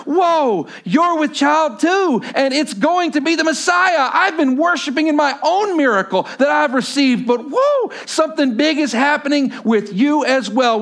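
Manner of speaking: high, loud, amazed exclamation; excited Elizabeth. Acted. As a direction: burst out in wonder as Elizabeth. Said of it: "Elizabeth goes".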